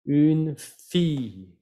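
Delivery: "Une fille" slowly, as "ün feee"; long I. Shown as a demonstration. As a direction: pause after "Une", hold the ee in "fille". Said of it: "como si fuera una I larga".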